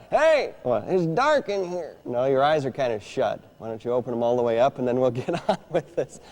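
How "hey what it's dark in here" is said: high, startled dummy; puppet voice. Acted. Dummy Orson with eyes shut, high startled voice. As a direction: wake up confused as the puppet.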